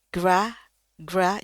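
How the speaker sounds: gra dengan a lebar sambil senyum. Shown as a demonstration. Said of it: "ini g, er, ah". (gra dengan a lebar)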